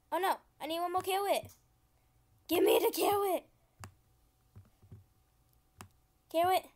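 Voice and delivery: high, loud mock-toddler demand; babyish whine.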